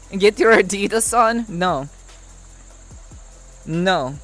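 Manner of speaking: deadpan put-on Russian accent. Streamer refusing chat the Adidas, Russian accent. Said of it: "we'll say it is Russian accent".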